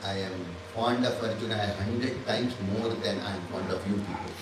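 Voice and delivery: a god softly admitting his fondness, chuckling; fond aside. Retold or imitated. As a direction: tell them gently who you favour. Ishvara confiding his affection.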